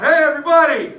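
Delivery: loud, high call across the field; retold yell. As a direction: shout it out to all the men. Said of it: "a yell goes out".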